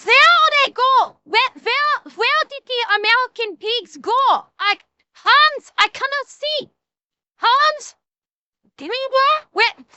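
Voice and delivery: high-pitched, hammed-up German soldier; panicked cries in a mock German accent. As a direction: squeal like a blinded German soldier. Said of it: "I gotta do German".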